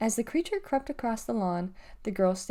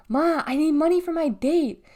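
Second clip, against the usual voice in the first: higher and louder, nagging at Ma; pestering teen; 6 semitones higher, 6 dB louder than usual. Acted. Montana pesters his mom for date money, nagging and raised. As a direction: pester your mother for cash. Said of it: "with Montana dogging her".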